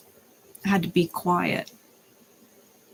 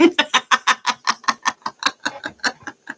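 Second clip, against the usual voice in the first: breathy laugh; 5 semitones higher than usual. Real, not acted. Laugh after joke.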